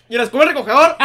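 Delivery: high, loud questioning demand; demanding manager. Retold or imitated. Manager asks barista for the broom, loud demand. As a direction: call it out accusingly.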